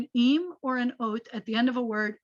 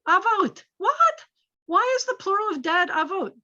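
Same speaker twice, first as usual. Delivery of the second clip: much higher, louder feigned disbelief at avot; mock surprise; 9 semitones higher, 4 dB louder than usual. Teacher to student, puzzling over the plural of dad, mock surprise. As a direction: act baffled by the plural, pitch jumping up.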